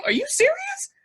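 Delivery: high-pitched "you can't be serious" imitation; mock incredulity. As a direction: imitate a disbelieving look in a high, loud voice. Grandmother's incredulous look, voiced.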